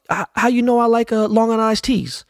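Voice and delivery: very high, squeaky put-on Dre voice; [name] impression. Dre asks the DJ how he knew his drink, very high mock voice. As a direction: push the voice way up in mock amazement. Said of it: "he's like".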